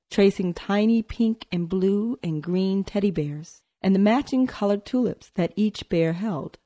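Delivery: plain reading voice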